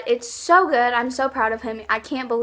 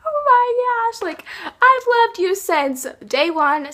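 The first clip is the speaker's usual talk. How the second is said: much higher, slow, breathless fangirl gush; gushing fan; 12 semitones higher, 4 dB louder, slower than usual. Pretend. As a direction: squeal it out to your idol, slowly. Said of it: "I'd be like".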